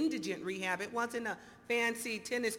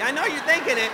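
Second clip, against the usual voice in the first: louder, pointed; loud teasing; 4 semitones higher, 12 dB louder, faster than usual. Shown as a demonstration. To the audience, teasing them loudly. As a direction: call out the crowd. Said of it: "repeats it louder".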